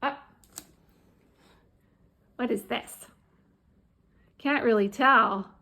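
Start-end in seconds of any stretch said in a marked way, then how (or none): none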